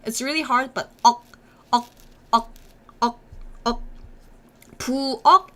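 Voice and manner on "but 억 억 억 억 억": "책, 액" and "억" each end on a k closed off in the throat; unreleased final k. Shown as a demonstration. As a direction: clip every syllable with the tongue shutting the throat. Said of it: "closing your throat with your tongue".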